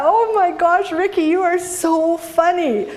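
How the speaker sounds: delighted, thinking he's joking; amused delight. Retold or imitated. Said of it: "And I thought".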